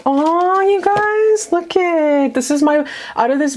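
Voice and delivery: high, bright burst of delight; squealing with real excitement; excited squeal. Real, not acted. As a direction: gush over the card like a giddy fan. Excited at seeing the card.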